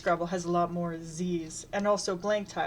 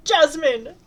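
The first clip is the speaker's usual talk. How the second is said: loud, much higher indignant shout; 8 semitones higher, 10 dB louder than usual. A narrator's reading. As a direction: yell the name to cut them off. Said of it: "He interrupts by shouting".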